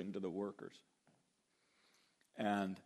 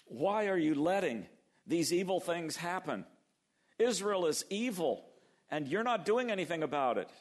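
Habakkuk complaining; 7 semitones higher, 5 dB louder than usual. Retold or imitated. Habakkuk's complaint to God voiced.

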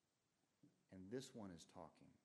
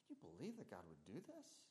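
Satan's feigned outrage, jumping much higher on "eat"; mock shock; 9 semitones higher than usual. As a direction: play the serpent stirring up shock, pitch leaping up at the end. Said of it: "he's actually raising shock and surprise".

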